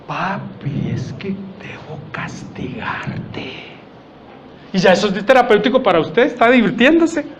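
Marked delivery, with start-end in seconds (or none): 0.1-3.5 slow, hushed, almost whispered; slow motion. Shown as a demonstration. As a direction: draw it out slowly and softly. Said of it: "en cámara lenta".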